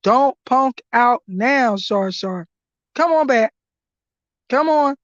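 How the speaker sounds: usual speaking voice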